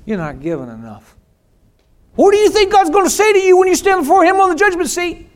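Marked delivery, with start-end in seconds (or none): 2.1-5.3 much higher, louder, fast ranting preacher; hammed-up preacher shouting at the flock; mock fire-and-brimstone preacher. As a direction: parody a guilt-tripping preacher at full blast. Mocking fear-based preachers.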